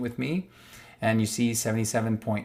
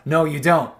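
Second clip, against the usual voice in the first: mock conceding officials; 4 semitones higher, 7 dB louder than usual.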